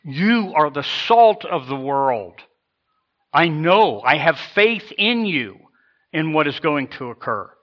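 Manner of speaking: loud, slow, emphatic declaration; amazingly confident. Acted. Jesus telling his ragtag followers he believes in them, confident. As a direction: proclaim it with total conviction. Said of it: "amazingly confident".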